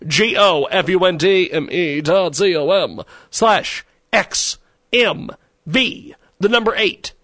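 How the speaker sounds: high, loud, slow spelling; slow spelled-out URL. Acted. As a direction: spell each letter out clearly. Spelling out donation link.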